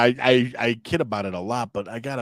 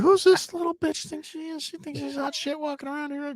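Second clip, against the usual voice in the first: very high-pitched mock indignation; hammed-up high, haughty voice; scornful disbelief. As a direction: squeal it as catty outrage. Voices the waitress's scornful reaction.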